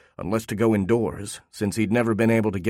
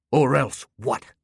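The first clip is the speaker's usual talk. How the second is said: slow, higher snarled challenge; 4 semitones higher, slower than usual. A narrator's reading. Talking dog challenging the owner's threat, a slow snarl. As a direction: throw it back slowly as a talking dog. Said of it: "he snarled".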